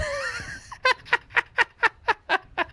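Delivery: high, breathless crying laugh; howling laugh. Real, not acted. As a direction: laugh till it hurts. Cracking up at own story.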